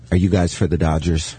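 low-pitched imitation of a kid; deep-voiced little boy. Retelling a kid questioning adult fans, lightly put-on deep voice. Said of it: "he was that deep of a voice".

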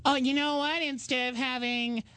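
high, chirpy suggestion; imitated girlfriend. Girlfriend at the grocery store suggests dessert, imitated perky voice. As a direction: imitate a partner's perky pitch. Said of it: "My girlfriend ... she'll go".

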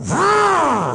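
high mock roar. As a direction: let out a silly high-pitched growl. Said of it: "We had our equipment on going".

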